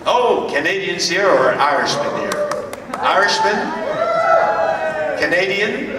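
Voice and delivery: high, animated question to the crowd; calling out to audience. Real, not acted. Speaker asks convention audience who is Irish or Canadian, calling out. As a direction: pitch it up and check who's out there.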